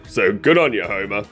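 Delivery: ordinary talk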